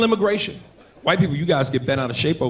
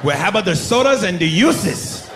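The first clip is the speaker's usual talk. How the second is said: put-on halting Mexican accent; much slower than usual. Cashier voiced, drawn out.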